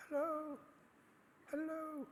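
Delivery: very high, soft, drawn-out hello; tiny high-pitched muffled call; tiny little voice. Retold or imitated. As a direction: squeak it faintly as if from far away. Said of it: "I heard this tiny little voice saying".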